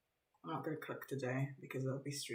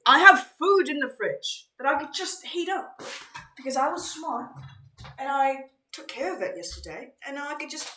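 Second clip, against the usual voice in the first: excited high squeal; 9 semitones higher, 11 dB louder than usual. Real, not acted. Realizes there's food in fridge.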